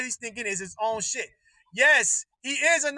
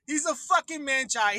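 ordinary talk

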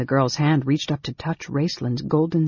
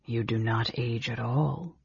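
softer, hushed statement; hushed wonder; 3 semitones lower, 8 dB quieter than usual. A narrator's reading. Mage realizes the girl never ages, hushed. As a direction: say it low-volume, as if realizing.

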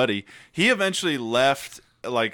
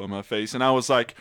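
normal talking voice

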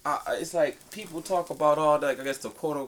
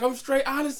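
high quoted voice; 12 semitones higher than usual. Retold or imitated. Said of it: "I'm like".

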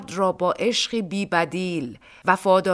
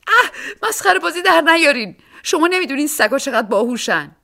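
much higher, louder indignant woman; 9 semitones higher, 7 dB louder than usual. A narrator's reading. Woman scolds the joking policeman, high and indignant. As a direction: scold him, pitched way up.

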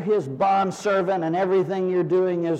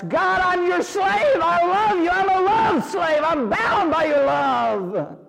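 fervent devotion; 11 semitones higher, slower than usual. Retold or imitated. Believer calls God his master, high and slow. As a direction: cry it out slowly in surrender. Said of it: "you come to that awareness".

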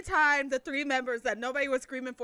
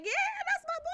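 high, proud squeal; retold fan cheer; much faster than usual. Fan cheering her favorite member, retold squeal.

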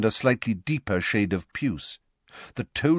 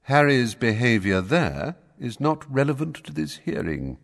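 polite with coolness; 6 semitones higher than usual. A narrator's reading. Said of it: "as politely as ever, coolness".

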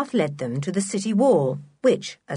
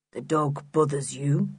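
low, toneless voice; expressionless character voice; 4 semitones lower, 3 dB quieter than usual. A narrator's reading. Block coming up to the children, expressionless.

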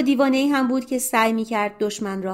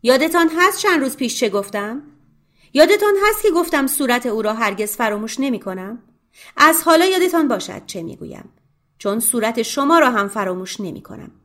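فریاد خشمگین و بلند، بالاتر از صدای راوی; هشداری خشمناک رو به مردان کور; angry shout; 3 semitones higher, 4 dB louder than usual. Acted. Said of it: "با خشم فریاد زد".

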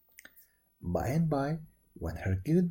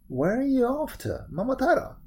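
high-pitched monkey character; 9 semitones higher, 4 dB louder than usual. A narrator's reading. Monkey questioning Momotaro on the road, high monkey voice. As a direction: ask it high, as an eager storybook monkey. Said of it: "said the monkey".

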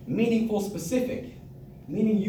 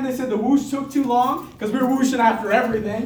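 ordinary delivery

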